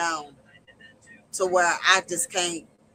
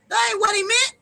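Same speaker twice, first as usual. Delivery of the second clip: pitched way up, stressing meant; much higher insistence; 13 semitones higher, 4 dB louder than usual. Real, not acted. Preacher insisting to viewers, high and emphatic. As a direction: repeat it higher, pressing on meant.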